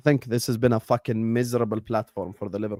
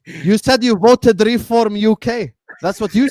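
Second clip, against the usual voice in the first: loud accusing; 7 semitones higher, 7 dB louder than usual. Real, not acted. Mock accusation, loud banter.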